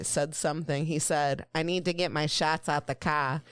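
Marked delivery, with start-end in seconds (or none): 1.5-3.4 imitated Boston accent, R-less "car". Retelling what a Boston musician said, Boston accent. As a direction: mimic a Boston band guy's accent. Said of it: "a band from Boston".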